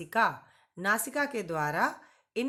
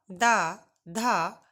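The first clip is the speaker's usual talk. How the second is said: slow drill; 6 dB louder, much slower than usual. Shown as a demonstration. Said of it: "teacher drilling consonant sounds".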